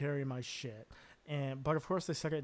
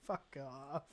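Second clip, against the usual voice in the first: softly muttered brush-off; retold dismissal; 6 dB quieter than usual. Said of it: "and you're just like".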